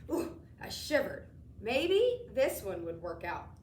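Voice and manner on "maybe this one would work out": ordinary delivery, nothing marked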